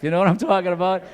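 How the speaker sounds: ordinary talk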